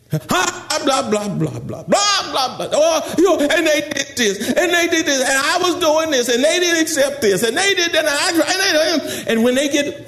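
high, run-on mock tirade; hammed-up complainer piling up grievances at high pitch; mad ranting. Mad church member vents every grievance, mock high ranting. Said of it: "they all mad and they stomp".

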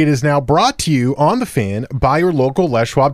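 loud radio announcer; announcer read. Real, not acted. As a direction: read the sponsor like a DJ. Radio host reads the sponsor.

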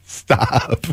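ordinary delivery

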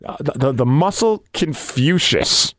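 slow, stammering announcement; reluctant. Retold or imitated. Ring announcer made to say the nickname, reluctant. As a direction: drag it out as if unwilling. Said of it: "but say it reluctantly".

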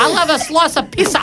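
mock Aussie.

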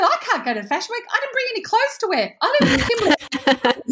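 high and fast, laughing through it; laughing panic. Retold or imitated. Telling the shop girls she has no clothes, laughing in panic. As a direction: blurt excuses, giddy and flustered.

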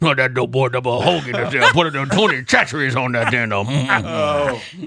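hammed-up Cajun football coach voice; Ed Orgeron. Host doing a coach impression on request, hammed-up Cajun mumble. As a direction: garble it like a Louisiana coach. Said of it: "can you do that in Ed Orgeron voice".

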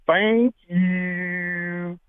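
drawn-out, high Georgia thank-you; Southern accent. Retold or imitated. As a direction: mimic a Southern caller, stretching the vowels. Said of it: "as far as Southern accents".

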